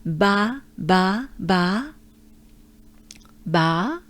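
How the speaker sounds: ba with an open French A; syllable drill in French. Shown as a demonstration. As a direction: repeat ba, open and clear. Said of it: "it comes in ba with a B".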